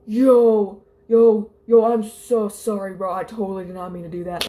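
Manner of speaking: mock a dude blurting out an apology; apologetic dude. Acted apology of ball thrower.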